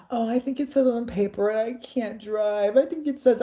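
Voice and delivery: high, drawn-out mock whine; bitching and moaning. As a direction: imitate a complainer moaning out every excuse. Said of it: "he's bitching and moaning like".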